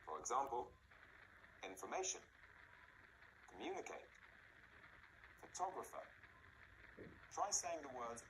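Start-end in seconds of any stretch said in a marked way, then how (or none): 1.6-6.0 infor-MA-tion, com-MU-nicate, pho-TOG-rapher; mid-word stress. Shown as a demonstration. Teacher giving long-word examples, middle stressed in information, communicate, photographer. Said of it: "almost always in the middle of the word".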